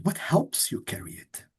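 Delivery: normal talking voice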